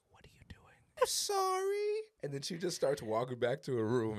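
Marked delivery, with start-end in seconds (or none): 0.1-0.6 hushed, voiceless whisper. Retold or imitated. Aunt to niece crouched in the playroom at night, whispered. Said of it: "And I whisper to her". 1.0-2.0 tiny high-pitched, whispery squeak; slow, squeaky child's whisper; little kid apology. Retold or imitated. Niece caught out of bed apologizing to aunt, squeaky child whisper. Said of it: "And I whisper to her".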